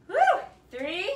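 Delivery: ordinary delivery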